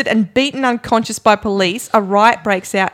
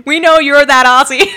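loud, higher mock American accent, laughing; bad American accent; 5 semitones higher, 8 dB louder than usual. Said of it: "tries to do a really bad accent".

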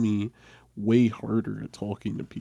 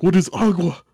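baffled teacher; 11 semitones higher, 6 dB louder, faster than usual. Retold or imitated. Teacher voiced baffled at child.

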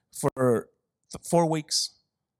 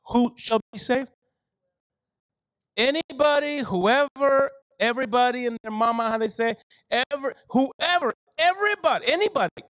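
much higher and faster, climbing to a peak; rising preacher fervor; 7 semitones higher than usual. Acted. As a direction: build the excitement, everybody and their mama. Preacher builds to a climax.

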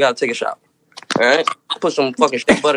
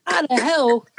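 outraged disbelief; 8 semitones higher than usual. Retold or imitated. Outraged at the dirty towel.

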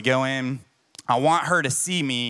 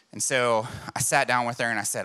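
ordinary talk, nothing marked

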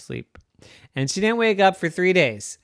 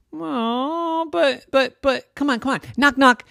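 high, pleading little-sister voice; 10 semitones higher than usual. Acted. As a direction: beg like a kid desperate to tell a joke. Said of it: "Her little sister would come up".

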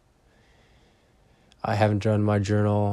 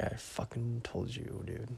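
much softer, nearly under the breath; quiet mock reproach; hushed told-you-so; 15 dB quieter than usual. Retelling his own reply.

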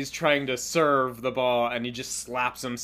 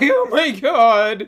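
mock sob; 7 semitones higher, 9 dB louder, slower than usual. Chika breaking into tears retold.

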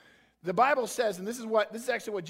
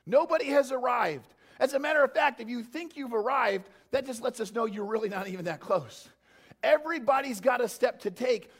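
raised, louder preacher's push; fired-up preaching; 4 semitones higher, 6 dB louder than usual. Real, not acted. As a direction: preach it to the room, full voice. Pastor preaching at full force.

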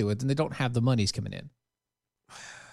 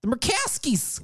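high, drawn-out name; mocking name-call; 10 semitones higher, slower than usual. Host repeating the senator's name, mocking slow high voice. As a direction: stretch the name mockingly, pitched up.

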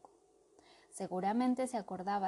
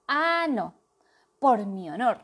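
loud objection; 2 semitones higher, 9 dB louder than usual. Acted. The knight cutting in, loud and indignant. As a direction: cut in on your honour. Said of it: "intervino Baldomero".

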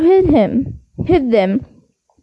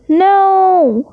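a sharp, slightly raised cry; mild yell of alarm; 5 semitones higher than usual. A narrator's reading. Ron swerving from the castle wall, yelling. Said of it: "Ron yelled".

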